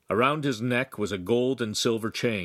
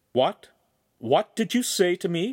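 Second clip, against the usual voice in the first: indignant, higher-pitched question; accusing; 8 semitones higher, 3 dB louder than usual. A narrator's reading. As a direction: demand an answer, affronted. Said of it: "whirling around accusingly".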